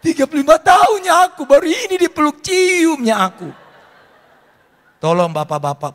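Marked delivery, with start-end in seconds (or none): 0.0-3.5 high, loud joyful cry, slowing at the end; overjoyed wife. Retold or imitated. Pastor voices wife hugged at church, high joyful cry. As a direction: ecstatic after thirty-five years, finally hugged.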